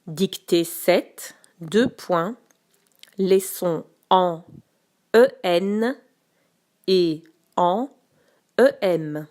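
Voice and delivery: slow, clear dictation to pupils. Shown as a demonstration. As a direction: read it out word by word. Teacher dictating slowly to pupils.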